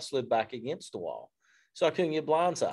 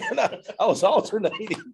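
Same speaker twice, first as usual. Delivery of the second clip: talking through a laugh; 8 semitones higher, 4 dB louder than usual. Real, not acted. Laughing at his own amphibious joke. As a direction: say it while laughing. Joking about being amphibious.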